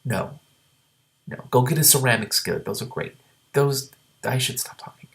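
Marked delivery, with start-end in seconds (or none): 3.5-5.1 whispered aside to herself; hush, scolding yourself; whispered self-reproach, half laughing. Real, not acted. Aside to herself mid-reading.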